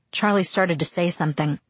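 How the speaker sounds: ordinary delivery, nothing marked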